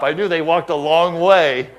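ordinary talk, nothing marked

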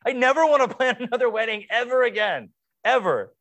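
high, loud laughing exasperation; exasperated sarcasm. Retold or imitated. Bride answering the well-meant advice, in a high, loud, laughing sarcastic retort. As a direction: snap back with fed-up sarcasm. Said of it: "I say".

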